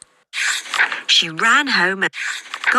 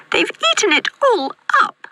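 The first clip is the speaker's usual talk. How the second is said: put-on very high, squeaky baby bear; tiny squeaky voice. A narrator's reading. Baby Bear about his empty bowl, tiny squeak. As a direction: squeal it as a tiny storybook cub. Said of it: "squeaked Baby Bear in his tiny voice".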